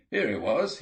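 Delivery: first cry of discovery, still held back; excited whoop. A narrator's reading. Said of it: "let out a whoop".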